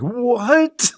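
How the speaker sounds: high startled "What?"; a sudden high squeak of surprise; mock surprise. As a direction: play the guy jumping in shock. Voicing the guy turning around.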